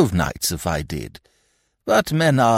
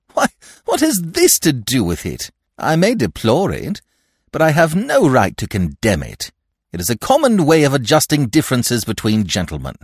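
impatient, higher and louder; 7 semitones higher, 4 dB louder than usual. A narrator's reading. Godfather waving away the killing, impatient. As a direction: brush it off impatiently. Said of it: "frankly impatient".

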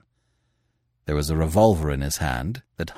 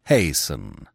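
ordinary delivery, nothing marked

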